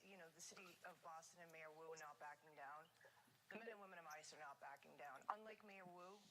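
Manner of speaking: ordinary delivery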